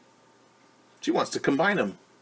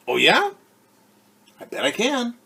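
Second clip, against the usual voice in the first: high, louder mock-defiant challenge; defiant retort; 13 semitones higher, 10 dB louder than usual. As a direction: take up the dare cheekily. Girl answers a teasing friend.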